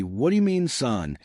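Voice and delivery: usual speaking voice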